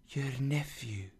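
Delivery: very soft ghostly murmur; hushed spirit. Acted. Spirit reminds Scrooge of his nephew, hushed. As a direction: barely voice it, like an apparition.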